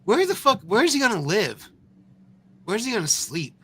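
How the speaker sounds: real exasperated outburst; incredulous shout. Real, not acted. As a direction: yell the question in disbelief. Incredulous rant at clip.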